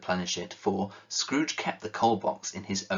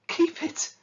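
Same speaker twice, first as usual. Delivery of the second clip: much higher, breathless disbelief; incredulous echo; 3 dB louder than usual. Acted. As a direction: repeat his word as if stunned. Nephew repeats in disbelief.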